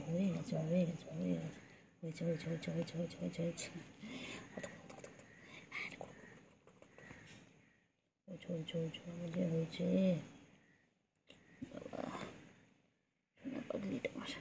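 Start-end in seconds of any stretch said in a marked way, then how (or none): 4.5-7.0 whisper. Real, not acted. Whispering to pet bird. 11.7-14.3 tender whispered endearment. Real, not acted.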